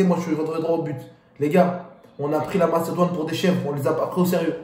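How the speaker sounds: ordinary delivery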